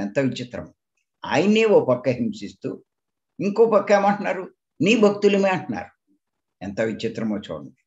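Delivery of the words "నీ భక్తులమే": high, loud mocking imitation of a false devotee; mock devotion. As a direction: sneer out their claim to be devoted. Mocking hypocrites' claim of devotion. (వెక్కిరిస్తూ, ఎత్తైన గొంతుతో గట్టిగా)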